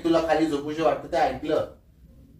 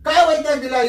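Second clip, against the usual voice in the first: raised, higher-pitched exasperation; real exasperated outburst, pitched up; irritated; 6 semitones higher than usual. Real, not acted. Husband arguing with wife about going to bed, irritated. Husband argues during sleep prank.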